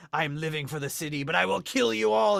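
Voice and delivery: menacing floating-head villain, rising to loud and high; mock movie villain. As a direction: build into a hammed-up evil threat. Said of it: "It looks like he's like".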